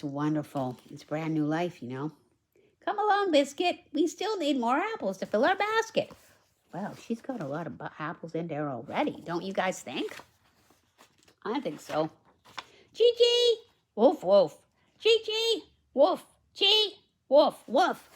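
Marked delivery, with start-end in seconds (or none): 2.8-6.1 put-on bright, high read-aloud voice for the little girl; high storybook girl. Reads girl's lines. 12.9-18.1 high puppy barks and squirrel chatter; yippy animal noises. Acted.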